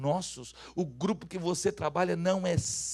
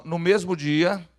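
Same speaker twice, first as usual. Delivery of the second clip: slow, loud call to repeat; saying it loudly; 9 dB louder, much slower than usual. Shown as a demonstration. Preacher has the congregation repeat after him, saying it loudly. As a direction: project each word slowly for the crowd. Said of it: "fala bem alto assim".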